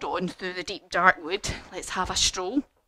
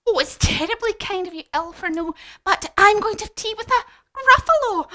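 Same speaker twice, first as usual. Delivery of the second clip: high, louder mouse voice; animated, pitched-up polite refusal; polite little mouse; 11 semitones higher, 7 dB louder, faster than usual. Acted. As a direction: decline brightly in a high mouse voice.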